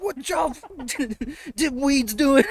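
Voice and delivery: high yelled outburst breaking into laughter; mock angry father. Father busting open the cabin door, high mock yell. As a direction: holler it high, half laughing. Acting father bursting in angrily.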